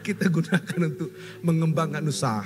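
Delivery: chuckling through the words; laughing. Real, not acted. Preacher joking to the congregation about loans, laughing. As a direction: laugh as you explain the joke.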